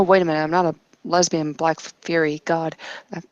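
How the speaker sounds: usual speaking voice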